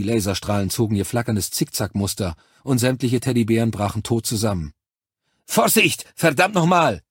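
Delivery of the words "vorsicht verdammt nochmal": high, loud angry yell; shouting. A narrator's reading. Cortez yells a warning at his soldiers, furious shout. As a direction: bellow the warning at the troops. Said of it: "schrie Cortez".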